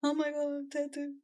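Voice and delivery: high-pitched mock gasp at a tattoo; mock freak-out. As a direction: ham up the shock, then drop off. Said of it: "so I'm not like".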